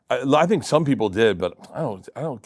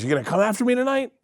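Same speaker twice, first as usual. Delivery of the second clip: playful challenge; 10 semitones higher, faster than usual. Retold or imitated. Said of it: "And he goes".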